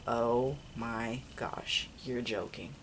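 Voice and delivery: slow and hushed, stunned by the news; slow, hushed disbelief at bad news. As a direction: let it sink in slowly, barely believing it. Hearing the cat died.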